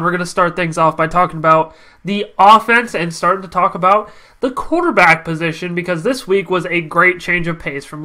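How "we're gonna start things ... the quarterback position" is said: ordinary talk, nothing marked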